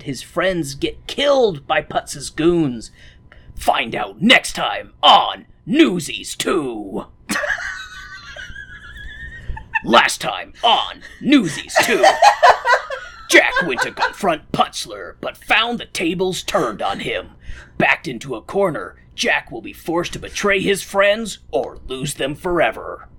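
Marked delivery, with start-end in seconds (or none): 3.6-7.1 slow booming TV announcer. Pretend. TV announcer parody. 9.8-23.1 dramatic TV recap voice; announcer recap. Pretend. TV recap parody.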